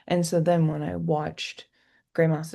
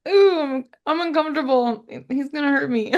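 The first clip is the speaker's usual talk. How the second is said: higher, laughing mock unease; mock unnerved; 8 semitones higher, 4 dB louder than usual. Fan acting out her own nervous reaction, laughing. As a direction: play scared and giggling.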